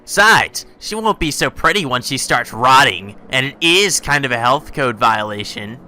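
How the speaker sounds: high, loud, gleeful cartoon bear; chirpy sadistic mascot, drawn-out and loud; Monokuma voice. Player voicing Monokuma to the students, high gleeful bear. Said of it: "Monokuma said to us".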